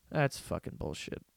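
whispered under the breath, fed up; a disgusted whisper to himself; muttered aside. Real, not acted. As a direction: mutter it in disgust, almost voiceless.

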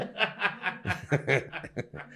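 a real laugh that bursts out high; a real laugh at the late hour. Real, not acted.

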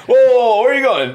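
loud laughing bouncer call. Pretend. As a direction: stop him at the door, loud. Mock bouncer stopping someone.

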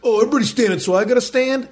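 high-pitched mocking retort; scornful mimicking. Seated man scoffing at the crowd, mock scornful. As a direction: parrot it back in a put-on high voice.

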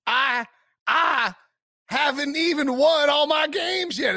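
high, slow, mock Southern drawl; imitating Southern accent. Host quoting the coach's speech, fake Southern accent. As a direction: stretch it into an exaggerated Southern drawl. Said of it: "He also said, he also said".

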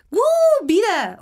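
grito de alegría agudo; emocionada. Retold or imitated. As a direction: festejar a todo pulmón. Celebra volver a salir. (¡uh!, eufórica)

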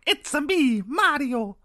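high, loud cartoon Italian Mario voice; fake Italian accent. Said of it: "Chris Pratt kept going".

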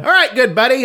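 high put-on trucker voice; mock CB radio buddy voice; imitating a character voice. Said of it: "using that voice to make that plane come down".

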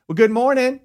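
high-pitched mock loud greeting; loud morning greeting. Partner startles man from under covers, high loud greeting. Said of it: "with a really loud voice".

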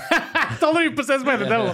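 laughing. Real, not acted. Laughs while telling prank.

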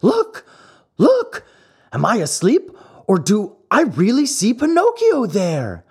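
very high, loud, astonished shouts; wild yelling. A narrator's reading. Harlequin stops the play and yells to the audience, very high and wild. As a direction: yell it out as if you can't believe your eyes. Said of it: "yelling wildly at the same time".

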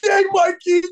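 put-on high, loud childlike cheer; excited cheer. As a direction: cheer like a kid at the movies. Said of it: "and shit, like".

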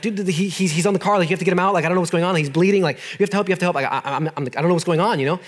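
tumbling, repeating mock-panicked plea; retold panic. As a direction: re-enact blurting urgently to a medic. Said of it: "and so I panicked".

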